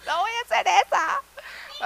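slow, very high-pitched protest; mock whining refusal. Woman refusing wedding planning without a ring, whining refusal. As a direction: stretch out a squealed refusal.

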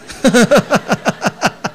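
a hearty burst of laughter; loud laugh. Real, not acted. Audience-facing laugh after joke.

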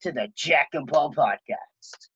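high-pitched, drawn-out podcast welcome; overdone host greeting, slowing into the show name; cringe intro. Real, not acted. Host welcomes listeners to the podcast, cringey intro voice. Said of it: "That was a pretty cringe intro".

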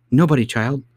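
almost angry. Acted. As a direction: brush the child off, mildly cross. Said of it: "almost angrily".

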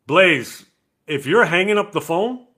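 loud, high call to a friend; loud callout with a teasing edge. Real, not acted. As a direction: call out so he can't miss it. Calling out to absent friend.

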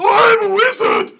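high, loud shouted wizard cry; wizard impression. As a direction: bellow it as a wizard. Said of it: "it's my impression of a wizard".

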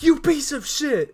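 high, loud angry curse; furious yell. Retold or imitated. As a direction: scream it at the pillow. Rick punches the pillow.

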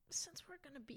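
sigh, then a quick, very soft mock plea; hammed-up hushed plea. Host voicing Zach's appeal to Susan, mock hushed.

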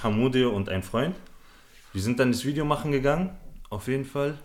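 ordinary delivery

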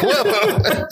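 high, falsetto mock whoa; a hammed-up high whoa. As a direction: gasp it out in a squeaky mock surprise. Retelling reaction to movie scene.

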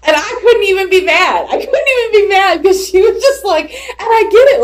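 can't stop laughing while telling it. Real, not acted. Mom recalling her daughter's curse, real laughter.

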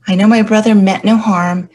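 usual speaking voice

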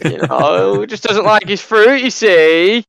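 jokey high-pitched lawyer's plea; mock defence lawyer. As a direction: plead the client's case in jest. Joins the mock courtroom defence.

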